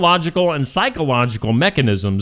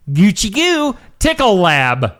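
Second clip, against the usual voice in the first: put-on playful baby talk; 5 semitones higher than usual. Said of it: "baby talk name of lab".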